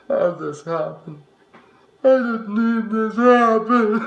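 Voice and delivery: anguished cry. Acted. Grieving his wife, anguished. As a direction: cry out in disbelief.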